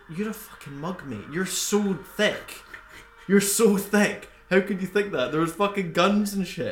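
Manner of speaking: mock fury at the stranger; imitating a rant. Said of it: "and it's like".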